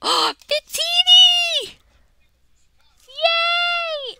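gasp, then a high, loud delighted cheer; high-pitched thrilled yay; excited shriek. Real, not acted. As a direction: squeal with joy at the pull. Pulls a favourite card.

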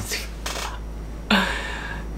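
a long soundless wheeze of a laugh; long breathless laugh. Real, not acted. Vlogger waiting for the police result, long breathless laugh. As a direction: laugh silently, almost out of air.

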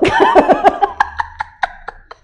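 high open laugh; hearty laugh. Real, not acted. Laughs aloud.

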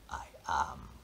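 faint whisper; whispered boast. Acted. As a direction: barely breathe it. Whispered claim.

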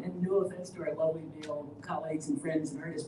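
ordinary delivery

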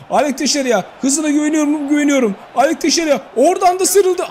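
high, shouted match call; thrilled commentator; excited commentary. Real, not acted. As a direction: yell as the winger breaks away.

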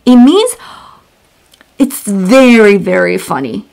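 normal talking voice

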